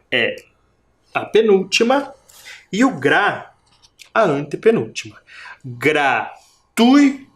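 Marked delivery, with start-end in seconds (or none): 5.6-7.2 Gratuito slowly split, TUI raised; GRA, TUI, TO apart, punching TUI; stress on TUI. Shown as a demonstration. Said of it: "O som parece que vem mais forte". (Gratuito em sílabas, TUI mais forte)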